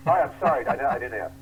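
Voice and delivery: high, loud flustered backpedal; startled apology. Retold or imitated. Cooper backing down to the GM, high loud flustered apology. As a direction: blurt out a panicky sorry.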